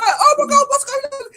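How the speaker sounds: shrieking high and loud with laughter; panicked bloke. Retold or imitated. Startled bloke in the dark woods, high panicked squeal. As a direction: squeal in alarm. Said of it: "He's like".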